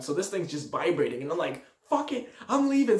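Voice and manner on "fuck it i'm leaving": high-pitched panicked resolve; mock panic, voice jumping up; acted panic. Tells himself to flee the firework, acted panic.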